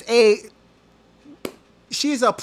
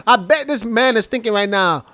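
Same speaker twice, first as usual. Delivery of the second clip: normal talking voice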